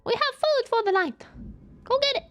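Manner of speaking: very high, eager dog yapping; excited pup pointing out food. Pretend. Pet dogs tell their owner about dinner, very high and eager. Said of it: "They're like".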